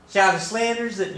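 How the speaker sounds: high, badly faked Texas accent; hammed-up Texas accent that doesn't quite land; attempted Texas accent. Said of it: "I can't do Texas".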